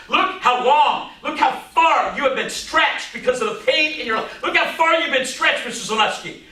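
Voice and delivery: high, mounting urgency as the teacher; retold climax, pitched well up and insistent; impassioned teacher. Teacher's climax acted out in story.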